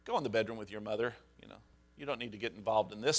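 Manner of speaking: low, soft and quick; retold calming words, quick and understated; calm aside. Husband sending arguing wife off to the bedroom, quietly calm.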